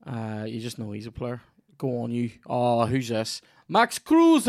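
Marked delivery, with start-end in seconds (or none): 3.7-4.5 high-pitched, loud German football commentator; German match commentary cry. Acted. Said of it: "that was my German commentary voice".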